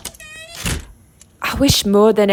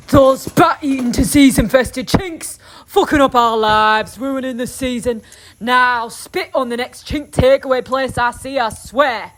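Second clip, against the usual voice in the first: angry husband rant; 4 semitones higher than usual. Acted. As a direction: spit it out with venom, as the husband. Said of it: "he's pretty angry".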